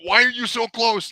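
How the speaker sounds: high, alarmed mock panic; panicked outburst. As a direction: squeal it like a startled passenger. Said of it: "I'm like".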